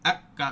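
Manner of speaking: আক closed off, then কা released open; আক shut tight, then কা let out; stopped then released. Shown as a demonstration. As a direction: contrast আক blocked with কা opened. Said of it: "আক যখন পড়ছে ওটা লেগে থাকছে".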